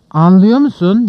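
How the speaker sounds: loud, accusing yell; angry shout. A narrator's reading. Old police chief advancing on Mitya, shouting. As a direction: shout it straight at the suspect. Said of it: "diye bağırmaya başladı".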